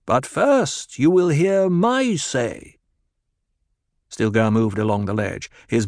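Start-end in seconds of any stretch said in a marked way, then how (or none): 0.1-2.8 much higher, slow, measured command; Paul using the Voice on the crowd. A narrator's reading. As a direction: bend them with every syllable. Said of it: "subtle intonations as he used the powers of voice".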